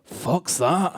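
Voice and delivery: put-on low, rough Glasgow accent; gruff, low Glaswegian disdain; Glaswegian girlfriend. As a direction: grunt it in surly Glaswegian. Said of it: "She looked at it and went".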